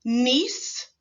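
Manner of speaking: "Niece," ending in a long voiceless s; teacher stretching the final s; final hiss. Shown as a demonstration. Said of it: "Last one".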